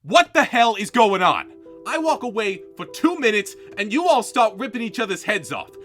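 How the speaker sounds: stern scolding. Acted. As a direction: scold like a boss. Host scolds contestants.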